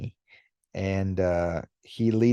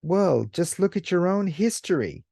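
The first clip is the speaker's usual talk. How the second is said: much higher, fast, reproachful lecture; accusing alien Oni; 9 semitones higher than usual. Retold or imitated. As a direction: throw their history back at them. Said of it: "And he says".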